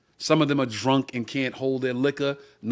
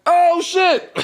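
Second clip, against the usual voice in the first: much higher, louder, stunned then laughing; 4 dB louder than usual. Real, not acted. Recalling his friend joining the fight, stunned laughter. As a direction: blown away and cracking up.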